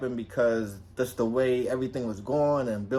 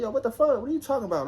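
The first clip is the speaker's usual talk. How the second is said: much higher disbelieving protest; incredulous protest; 11 semitones higher, faster than usual. Retold or imitated. Denying the accusation to his girlfriend, high and incredulous. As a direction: push back in high disbelief.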